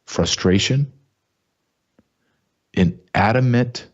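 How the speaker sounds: normal talking voice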